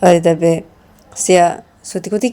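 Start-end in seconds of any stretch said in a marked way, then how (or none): none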